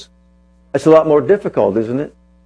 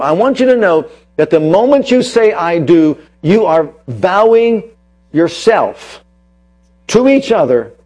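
raised, emphatic sermon voice, punching key words; preaching; 4 semitones higher, 4 dB louder than usual. Acted. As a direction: drive the point home to the congregation. Preacher counsels couples.

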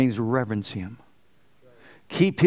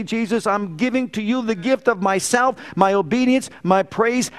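voiced praise prayer; 6 semitones higher than usual. Worshipper thanking Jesus aloud, high fervent praise. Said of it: "honor you with my voice and say".

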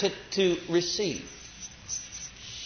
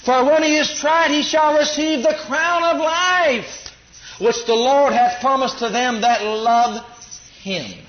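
loud, slow, high pulpit preaching that rises to a shout; fiery preaching; 6 semitones higher, 5 dB louder, slower than usual. Acted. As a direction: preach it out hard to the congregation. Preaching at full force.

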